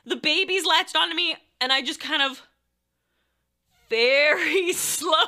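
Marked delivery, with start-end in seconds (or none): 3.9-5.2 slow words broken by a laugh; drawn-out slowness. Retold or imitated. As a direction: stretch each word out slowly. Acting out a stealthy slow reach.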